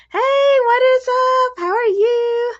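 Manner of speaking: high, loud sing-song greeting. Real, not acted. Breeder greets her gecko in high, loud baby talk. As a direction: coo up high at the little gecko.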